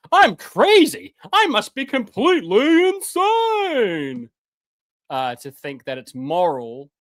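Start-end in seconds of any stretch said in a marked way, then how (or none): 0.1-4.3 high, loud, slow sarcastic "I'm crazy"; sarcastic mock madness. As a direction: stretch "crazy" out in mock horror.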